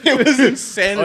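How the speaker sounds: high, loud words through laughter; laughing while talking. Real, not acted. Sailor recalls the lobster bisque watch to friends, laughing. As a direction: laugh the line out.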